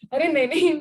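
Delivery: ordinary talk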